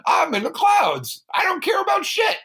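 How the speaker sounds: high, loud, shouting bravado; brash boast. Retold or imitated. Jack introducing himself to the princess, loud and high. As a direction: yell it out like a proud fool.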